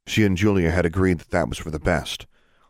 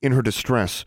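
plain reading voice

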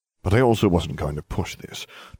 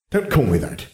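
mildly snappish retort; snapped; 4 semitones higher than usual. A narrator's reading. Harry objecting to being called Tom Riddle, snapping. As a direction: cut in with mild irritation, slightly raised pitch. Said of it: "I mentally snapped".

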